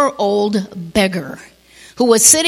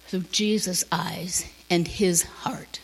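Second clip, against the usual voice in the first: whispered; 4 semitones lower, 10 dB quieter than usual. Real, not acted. Closing a point, hushed.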